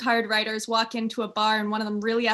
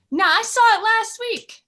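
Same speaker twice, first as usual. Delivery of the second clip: insistent; 12 semitones higher, 7 dB louder than usual. Acted. Insisting retort in joke.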